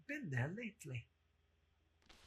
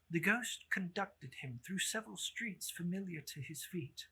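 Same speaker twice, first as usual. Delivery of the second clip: ordinary delivery, nothing marked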